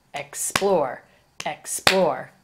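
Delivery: ex-PLORE, second syllable punched; stress on second syllable of explore. Shown as a demonstration. Teacher showing the accented syllable, stress on PLORE in explore. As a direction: lean hard on "plore" in explore. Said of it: "The second syllable is accented, more emphasis".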